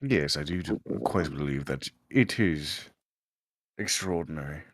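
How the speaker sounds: mock British gentleman accent. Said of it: "I'll do my best British accent".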